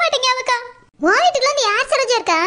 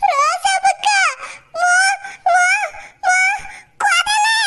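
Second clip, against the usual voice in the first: panicked call; 7 semitones higher, much slower than usual. Acted. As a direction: scream for the neighbour in terror. Panicked calling to neighbour.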